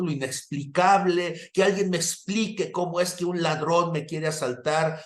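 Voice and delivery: voz muy aguda de predicador; prédica enfática. Real, not acted. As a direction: interpela a la congregación con fervor, en tono alto.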